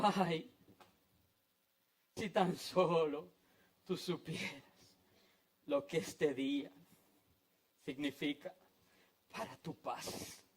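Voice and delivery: soft, low and broken by long pauses; hushed, aching lament without sobbing; sorrowful lament. Acted. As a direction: grieve quietly over the city, fading away. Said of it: "vio esa ciudad y lloró".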